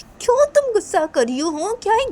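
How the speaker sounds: high, loud reproach; exasperated. Acted. Friend asking another why she is angry, high and exasperated.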